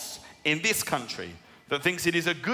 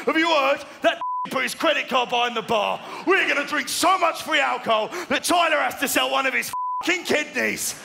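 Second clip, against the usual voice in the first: excited cheering quote; 9 semitones higher than usual. Retold or imitated. Colleagues rallying each other to drain the free tab, excited and high. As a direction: hype each other up over free drinks. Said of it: "people having pep talks, cheering".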